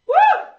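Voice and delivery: a high, loud cheering whoop; excited whoop. Real, not acted. Instructor whooping at the class mid-set, loud and high.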